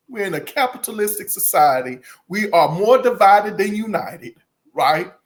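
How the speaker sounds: ordinary talk, nothing marked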